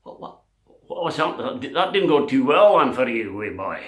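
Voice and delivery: loud taunt in dodgy Scottish; mock-Scottish gloating, falling away at the end; bad Scottish accent. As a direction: jeer as a badly done comic Scot. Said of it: "That's not a very good Scottish accent".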